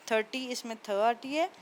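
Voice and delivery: normal talking voice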